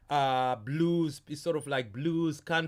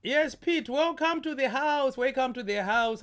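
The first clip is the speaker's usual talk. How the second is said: much higher, louder cheery greeting; jolly high welcome; 7 semitones higher, 3 dB louder than usual. Acted. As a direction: welcome him like a beaming host.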